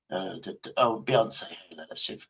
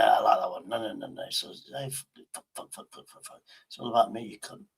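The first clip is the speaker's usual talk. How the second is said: usual speaking voice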